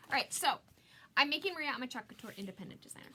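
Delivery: normal talking voice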